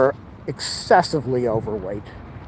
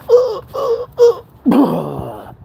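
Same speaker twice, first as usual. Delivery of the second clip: high, strained groans; mock toilet groan; 4 dB louder, much slower than usual.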